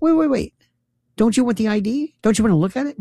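high-pitched insistent questioning; baffled protest. Retold or imitated. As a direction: press the poll worker in disbelief, voice up. Retold protest to poll worker.

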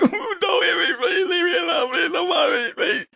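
high, whimpering mock beg; mock pleading. Host mimics beaten Democrats begging not to be hit, mock whimper. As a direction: plead in a high, cowering put-on voice.

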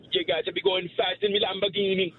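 high Jamaican accent with a laugh. Shown as a demonstration. Caller performing on the hosts' request, Jamaican accent. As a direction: play it up in high-pitched Jamaican. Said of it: "No, a Jamaican accent".

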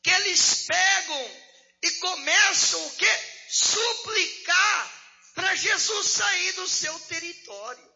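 preaching at full force. Acted. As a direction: preach it out loud and high to the church. Preacher climax about Gadarenes. (pregação exaltada, em voz alta e forte)

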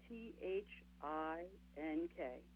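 slow letter by letter, T H I N K; spelled out slowly. Shown as a demonstration. Preacher spelling an acronym to the congregation, slowly. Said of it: "Use think as an acronym".

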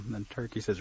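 ordinary delivery, nothing marked

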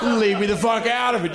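ordinary talk

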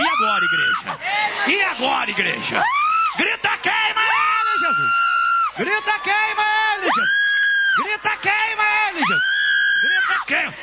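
high shouted calls to the congregation; rallying preacher. Real, not acted. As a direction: whip the church into shouting along. Preaches at full force.

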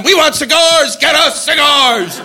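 slow high chanted demand; hammed-up crowd chant; mock screaming crowd. UN crowd demanding cigars, slow mock chant. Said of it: "they were screaming at in the U N".

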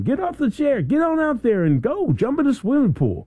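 high-pitched pep-talk urging; motivational speaker. Acted. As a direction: play a coach rousing people into action. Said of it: "you gotta hear somebody tell you something".

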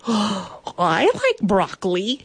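slow, drawn-out mock astonishment; dramatic shock. Knight reassuring a lonely bandit, slow dramatic surprise. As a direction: stretch it out in hammed-up surprise. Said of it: "gasped dramatically".

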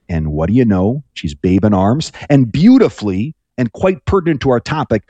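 ordinary talk

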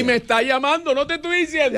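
very high, excited cry; real excited outburst. Real, not acted. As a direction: call out the news in a high, triumphant voice. She calls back as he predicted.

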